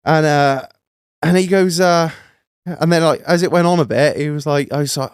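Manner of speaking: usual speaking voice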